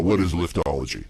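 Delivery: ordinary talk, nothing marked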